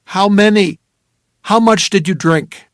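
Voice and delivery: loud, sharp questions; loud demand to a drugged woman; urgent demand. Acted. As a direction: hammer her for an answer. Demanding answers.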